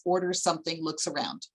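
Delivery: usual speaking voice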